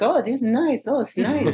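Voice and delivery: put-on high, sweet little old Italian mother voice; mock Italian mom, charmed; imitating little Italian mom. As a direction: coo it like a tiny delighted mom. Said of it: "my mom was a little Italian lady".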